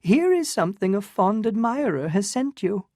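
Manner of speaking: put-on high, affected elderly lady; fluttery old aunt. Medora tells Newland about the Count, high and affected. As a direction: play a gushing society aunt.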